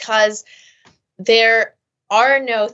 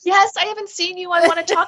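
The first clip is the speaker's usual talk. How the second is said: higher-pitched, gushing mock delight; eager acceptance; 8 semitones higher than usual. Reacting to a welcome Zoom happy hour invite, eagerly. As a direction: act out thrilled to hear from a friend.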